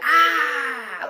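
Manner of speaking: loud, high triumphant "ah"; sustained hyped-up shout, imitated; hyped mimicked yell. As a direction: imitate a baby's excited battle cry. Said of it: "he gets real hyped".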